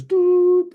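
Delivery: one long, high, loud toot; sustained imitation ram's horn; tekiah shofar blast. Shown as a demonstration. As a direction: hold a single horn note. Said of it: "That's how they sound".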